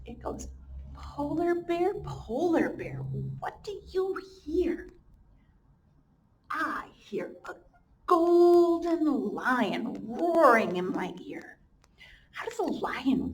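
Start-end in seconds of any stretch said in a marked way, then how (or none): 0.9-4.9 sing-song slow storybook read; reading to children. Acted. Teacher reads the refrain aloud to her class, slow and sing-song. 6.5-11.6 slow, drawn-out storybook answer; reading to children. Acted. Teacher reads the animal's answer to her class, drawn out.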